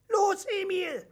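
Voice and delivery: high squeaky call to action; fearless mouse call. A narrator's reading. Mouse on the dragon's nose orders Emil to start, fearless. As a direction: urge him on in a tiny high voice. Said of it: "rief es furchtlos".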